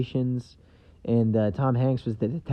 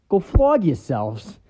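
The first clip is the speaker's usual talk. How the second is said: slow, louder smug "flog" punchline; deadpan TV-version punchline; 7 semitones higher, 8 dB louder, slower than usual. Retold or imitated. Detective joking in the censored TV version, smug. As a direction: hit "flog" with a sly grin. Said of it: "little smirk".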